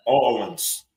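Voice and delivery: "Orleans" as AW-lins, drawn out; local pronunciation. Shown as a demonstration. Contrasting city pronunciations, "Orleans" as slow AW-lins. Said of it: "to pronounce Orleans".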